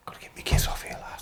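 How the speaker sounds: hushed whisper to himself; a low secret whisper, plotting the move; whispered aside. Retold or imitated. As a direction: whisper it so the guards can't hear. Sneaking past security guards.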